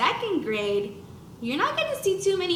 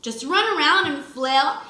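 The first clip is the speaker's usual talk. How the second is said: plain reading voice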